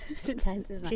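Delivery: breathy laugh into the mic; breathing hard into the mic; heavy nose breathing. Real, not acted. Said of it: "I'll stop breathing into the mic".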